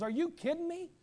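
high, loud panicked voice; panicked Gibeonites. Retold or imitated. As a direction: whine in alarm. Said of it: "they said to themselves".

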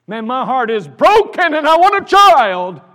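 loud, high cry of longing; anguished outburst. Acted. Woman pleading with priest over her childlessness, anguished outburst. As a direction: build into a full wailing shout.

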